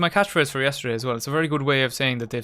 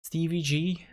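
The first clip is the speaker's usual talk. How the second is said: higher-pitched attempt at Scouse; Liverpool accent; 4 semitones higher, 3 dB quieter, slower than usual. Shown as a demonstration. Streamer naming a Liverpool captain, mock Liverpool accent. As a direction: put on a brief Liverpool accent. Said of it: "attempt to do the Liverpool accent".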